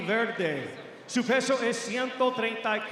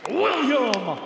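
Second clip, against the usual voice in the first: booming arena call, higher and louder; lucha libre announcer; 6 semitones higher, 4 dB louder than usual. Acted. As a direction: drag out the fighter's name for the crowd. Main fighter introduction.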